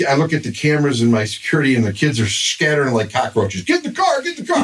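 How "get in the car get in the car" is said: high, rushed get-away urging; urgent hurry-up call. Retold or imitated. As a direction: hustle everyone out fast. Kids scattering, fleeing the scene.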